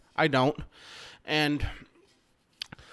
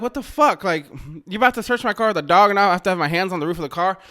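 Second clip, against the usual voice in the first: loud, high, fast indignant rant; outraged yelling; 4 semitones higher, 5 dB louder, faster than usual. Retold or imitated. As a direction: rush it out in disbelief. Outraged at being searched.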